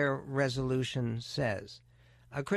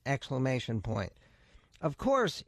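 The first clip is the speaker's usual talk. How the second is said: ordinary talk, nothing marked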